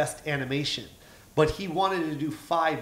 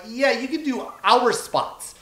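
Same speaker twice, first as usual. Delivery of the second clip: eager offer; 9 semitones higher, 4 dB louder, slower than usual. Retold or imitated. Eagerly gives up their spots.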